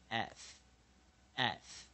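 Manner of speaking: "as" pronounced "eth", with a voiceless TH ending; teacher modelling "eth" for "as", slowly; short E plus TH. Shown as a demonstration. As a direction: say "as" as a slow, separated "eth". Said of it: "所以我们念".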